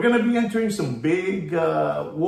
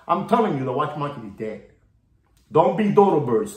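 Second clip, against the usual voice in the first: ordinary talk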